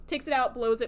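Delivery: ordinary talk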